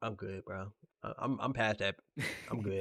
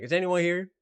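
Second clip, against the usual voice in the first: mock impatient customer; 8 semitones higher, 7 dB louder than usual.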